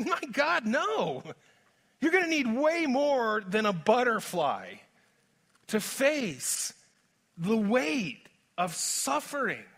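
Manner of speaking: high, slow and pleading; Paul's emphatic protest. Retold or imitated. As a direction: plead it as Paul, slowing to the end. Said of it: "Paul is saying".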